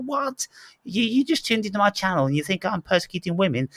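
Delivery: high-pitched incredulous protest; mock incredulity. Host replaying his reaction to a commenter, incredulous. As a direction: react in baffled disbelief, pitched well up.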